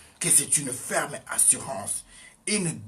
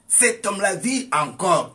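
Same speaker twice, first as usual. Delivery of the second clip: much higher and louder, punching vit; loud proclamation; 7 semitones higher, 7 dB louder than usual. Shown as a demonstration. Preacher to congregation, loud triumphant repeat. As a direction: declare it like a victory cry.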